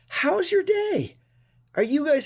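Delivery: beam it out to the viewers; bright upbeat hello; cheery greeting. Real, not acted.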